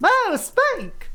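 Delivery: high, excited "Whoa, Spike!" with a long drawn-out "Whoa"; "Whoa, Spike!" called out high and delighted, stretching "Whoa"; excited shout-out. Real, not acted. Thanking a raider on stream.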